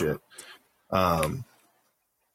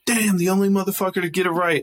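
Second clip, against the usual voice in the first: quick, high, amazed exclamation; fast amazed quote, pitched up; impressed surprise; 10 semitones higher, faster than usual. Retold or imitated. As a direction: blurt it out impressed. Quoting impressed first sergeant.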